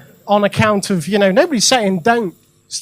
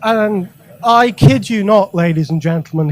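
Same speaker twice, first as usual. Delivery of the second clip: normal talking voice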